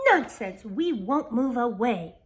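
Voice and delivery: loud, defiant refusal; firm outcry against leaving; defiant cry. A narrator's reading. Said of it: "Mirabella cried".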